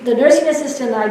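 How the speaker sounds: ordinary delivery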